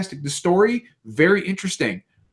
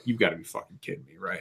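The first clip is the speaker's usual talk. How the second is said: muttered disbelief; 4 semitones lower, 12 dB quieter, faster than usual. Retold or imitated. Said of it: "And I'm like".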